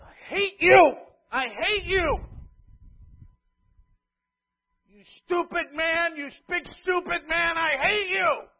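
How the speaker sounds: high, loud mock kid screaming I hate you; angry child tantrum. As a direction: yell like a furious little kid. Angry child yelling at parent.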